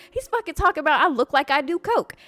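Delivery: loud, high aggrieved outburst; indignant complaint. Retold or imitated. Storyteller telling two men what happened, indignant. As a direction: vent the insult at full steam.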